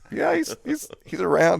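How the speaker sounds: high, squeaky dodge; mock innocent fib; evasive cover. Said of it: "I'm like".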